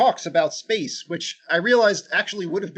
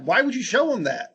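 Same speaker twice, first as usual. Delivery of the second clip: usual speaking voice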